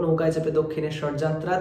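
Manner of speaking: usual speaking voice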